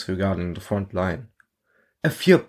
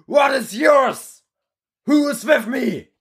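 rallying shout; slower than usual. Acted. Instructor rallying the cheering hall, rallying shout. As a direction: whip the crowd into a roar.

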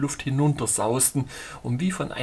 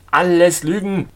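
loud, raised-pitch dismissal; insistent denial; 5 semitones higher, 9 dB louder than usual. A narrator's reading. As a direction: deny it stubbornly and loudly. Stubborn denial in an argument.

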